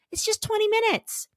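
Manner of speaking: small high voice; high-pitched. Shown as a demonstration. High aside, then repeated plainly.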